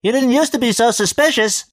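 high, loud cartoonish teasing; old friend teasing through the door; comedy voice. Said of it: "somewhere between Michael Jackson and a Mel Blanc cartoon character".

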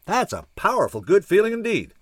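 high, hearty call across a room. A narrator's reading. Chuck chimes in across the bar, calling out high. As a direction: call out your hearty agreement, pitched high. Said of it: "came a voice from across the room".

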